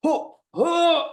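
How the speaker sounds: high, scoffing mock laugh; high snide huffs of a commenter. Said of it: "a little harsh and a little like".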